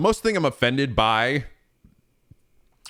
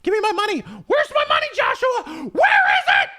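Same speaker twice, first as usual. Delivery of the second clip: much higher hammed-up shrieking demands; mock furious demands. Player demanding his money back from Joshua, high shrieks. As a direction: shriek the demands while cracking up.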